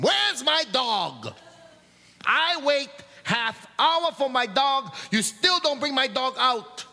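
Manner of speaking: high, slow, furious demand; angry yelling. Retold or imitated. Upset lady yells at the baggage clerk Glenn, high angry demand. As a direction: scold the clerk like a wild customer. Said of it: "she was yelling at Glenn".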